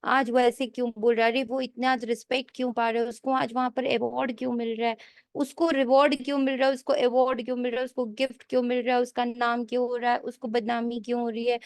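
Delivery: rapid-fire string of nosy questions; mimicking an itch to know, fast; restless gossipy curiosity. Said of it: "उछाल आए मन एकदम".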